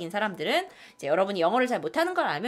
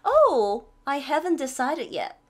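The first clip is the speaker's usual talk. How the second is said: higher "Oh", sighing regretful line; expressive regret; 4 semitones higher, much slower than usual. Shown as a demonstration. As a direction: act a little sorry. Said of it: "이렇게 하지 말고". (아쉬운 듯 감탄사 넣어)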